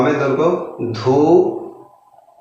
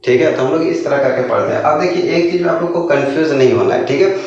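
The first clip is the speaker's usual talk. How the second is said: normal talking voice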